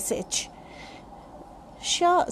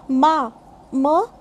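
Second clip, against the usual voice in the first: normal talking voice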